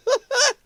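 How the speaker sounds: short, high-pitched burst of laughter; high startled laugh. Real, not acted. Streamer reacting to a viewer's horse accident, high laugh.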